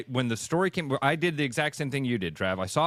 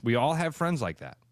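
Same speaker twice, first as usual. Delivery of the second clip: ordinary talk, nothing marked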